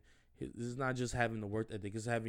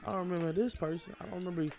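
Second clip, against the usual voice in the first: higher, animated mock disbelief that turns to encouragement; replaying a text to a friend acting strange, higher and animated; playful scolding text; 9 semitones higher than usual. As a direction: hype up an old friend. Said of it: "I'm gonna slide up and say".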